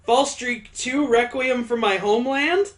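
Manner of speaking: high, rising incredulous question. Real, not acted. Reader reacting to the sequel title, incredulous high voice. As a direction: read the title out high in disbelief.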